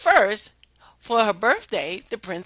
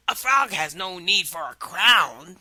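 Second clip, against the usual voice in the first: frog in a changed timbre; odd-toned frog voice; 5 dB louder than usual. Acted. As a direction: answer as the calm frog.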